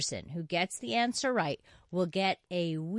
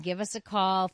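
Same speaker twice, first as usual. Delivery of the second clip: ordinary delivery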